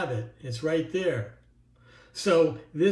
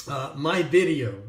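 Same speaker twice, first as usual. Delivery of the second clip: "my" slowed and leaned on, a bit louder; stress on my; 2 semitones lower, 3 dB louder than usual. Shown as a demonstration. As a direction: hit the word "my" hard in "my video". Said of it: "I emphasize the word my".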